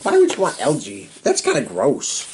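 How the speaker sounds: ordinary delivery, nothing marked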